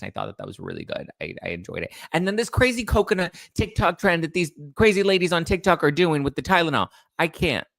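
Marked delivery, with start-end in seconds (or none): 2.1-7.7 mock disbelief. Mocking crazy TikTok ladies.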